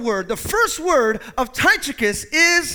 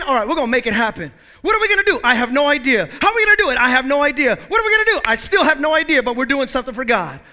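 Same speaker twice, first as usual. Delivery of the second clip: eager charge; 3 semitones higher, 6 dB louder, faster than usual. Retold or imitated. Bold believer to hesitant friends, excited and loud. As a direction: rush in, no plan, all zeal. Acted eager Tychicus guys.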